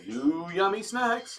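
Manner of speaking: drawn-out slogan, slow; slow catchphrase delivery. Acted. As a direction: savor each word slowly. Segment catchphrase.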